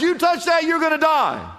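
high, loud, stern proclamation; God's stern warning. Shown as a demonstration. Pastor voicing God's command to the woman, high and stern. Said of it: "now watch this".

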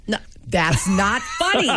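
slowed, laughing through "No, that's not funny."; real laugh breaking into the words; laughing protest. Real, not acted. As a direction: scold the joke but crack up. Scolding a joke while laughing.

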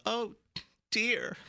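a hammed-up little panic; mock freaking out. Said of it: "then she's freaking out".

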